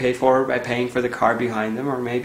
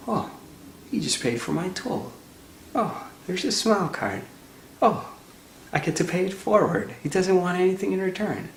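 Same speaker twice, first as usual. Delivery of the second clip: lightly acted wonder, pitched up; retold amazement; 7 semitones higher than usual. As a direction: imitate someone softened by an unexpected kindness. Angry driver's astonished realization, acted.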